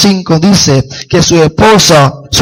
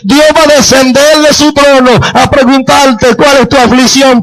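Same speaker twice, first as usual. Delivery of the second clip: high-pitched, insistent sermon delivery; impassioned preaching; 7 semitones higher than usual. Real, not acted. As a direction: preach it with urgent conviction. Preaches with mounting force.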